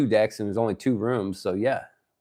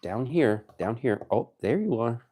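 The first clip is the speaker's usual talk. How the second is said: normal talking voice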